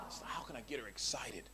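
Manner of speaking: hushed, plotting whisper; conspiratorial aside to the crowd. Acted. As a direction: whisper it like a secret plan. Scheming aside about a date.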